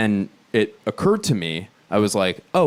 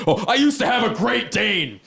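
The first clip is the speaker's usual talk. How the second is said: high-pitched mock-aggrieved customer; complaining big guy; 10 semitones higher than usual. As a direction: protest it as a big guy let down. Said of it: "there's a big guy there being".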